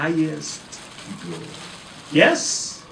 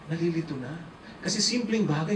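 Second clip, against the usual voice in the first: ordinary talk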